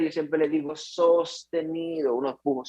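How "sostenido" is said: "sostenido" stretched out slowly; drawn out. Shown as a demonstration. Doctor teaching how to push, drawing out "sostenido" slowly. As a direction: hold "sostenido" long, like a sustained push. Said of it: "los pujos tienen que ser".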